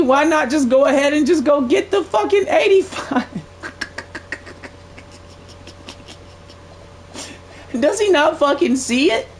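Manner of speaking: cracking up at how dumb the bidder is; laughing in disbelief. Real, not acted. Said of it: "this shit's hilarious to me".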